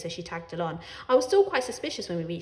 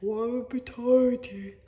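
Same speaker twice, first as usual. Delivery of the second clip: mock menace; 3 dB louder, much slower than usual. A narrator's reading. Mimicking father's threat.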